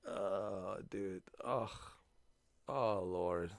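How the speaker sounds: normal talking voice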